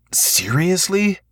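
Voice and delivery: can't believe this, drawn out; exasperated disbelief. A narrator's reading. Repeated, disbelieving.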